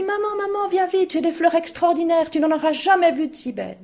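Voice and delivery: put-on high, eager child's call; bursting with joy over the flowers; excited girl. Girl calling mother to see her flowers, high and thrilled. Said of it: "Elle dit".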